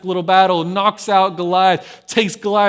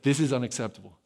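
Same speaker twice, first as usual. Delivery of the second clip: low, softer retold insistence; adamant; 6 semitones lower, 6 dB quieter, faster than usual. Teenage David stands his ground, quietly adamant. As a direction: insist firmly without raising the voice, lightly acted. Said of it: "he's adamant".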